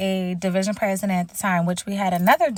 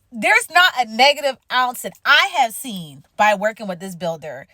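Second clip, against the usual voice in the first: usual speaking voice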